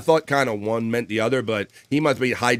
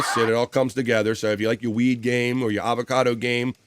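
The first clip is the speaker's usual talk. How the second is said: ordinary talk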